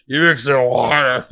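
loud, slurred drunken mockery; impression of drunk self. Drunk man mocking his buddy for drinking water, slurred and loud. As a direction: jeer it like a sloppy loud drunk. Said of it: "his impression of how drunk I was".

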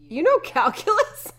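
high, loud incredulous question; imitated daughter's disbelieving squeal; surprised daughter. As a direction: pitch it way up as an astonished girl. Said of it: "his daughter goes".

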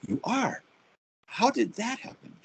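high, amazed question; astonished disbelief; surprised bishop. Retold or imitated. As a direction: sound taken aback, then curious. Bishop surprised at Protestant minister.

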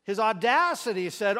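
normal talking voice

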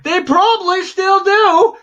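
high, loud insistent comeback; worked-up yelling. Real, not acted. Contradicting co-host about a band, worked-up yelling. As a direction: yell the contradiction back.

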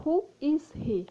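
high "Who", slow phrase; stressed model question. Shown as a demonstration. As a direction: lift the question word high. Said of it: "você vai perguntar".

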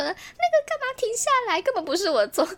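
high, huffy voice of a friend protesting her innocence; mock indignant complaint. Retelling what Xiao Mo would say, high and huffy. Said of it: "说：".

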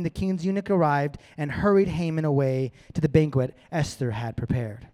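usual speaking voice

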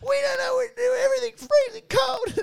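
very high, crying, shouted mock whine; hammed-up teary squeal; mock whiny wail. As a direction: wail it like a helpless girl on TV. Mimicking girls whining on show.

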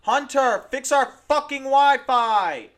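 high, loud furious shout; angry yelling. Pretend. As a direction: scream at Hunter down the hall. Calling out angrily.

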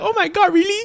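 squeaks up very high in surprise; voice cracking high. Retold or imitated. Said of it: "Then I'll be like".

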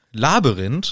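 "Labyrinth" with a strong stress on LA; stress first syllable. Shown as a demonstration. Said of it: "die betonen immer die erste Silbe". („Labyrinth“ mit Betonung auf LA)

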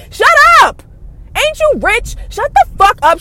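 high, loud, fed-up yell; exasperated high-pitched outburst; angry shout. Real, not acted. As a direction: yell it to cut her off. Angry rant, telling her off.

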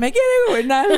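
very high mock refrain, laughing; imitating backup chorus. Podcaster mimicking the band's little chorus, high mock refrain. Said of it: "El corito".

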